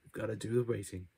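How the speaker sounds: ordinary talk, nothing marked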